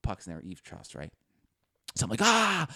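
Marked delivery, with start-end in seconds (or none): 2.2-2.7 high loud startled cry; scared yelp. Retold or imitated. Boy seeing the puck land in the eaves, high scared yelp. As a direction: yelp in fright. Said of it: "So I get scared".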